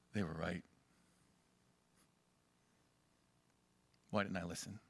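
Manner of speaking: quiet, low rueful admission; soft regret. Retold or imitated. Sinner looking back at the path, quiet regret.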